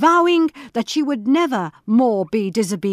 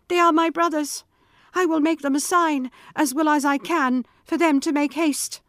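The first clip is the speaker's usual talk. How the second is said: relieved excitement; 7 semitones higher than usual. Acted. Wife's relief.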